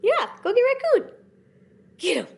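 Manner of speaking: high, excited egging-on of the cat; playful pet talk. Real, not acted. Urging her cat to chase the toy raccoon, excited and high.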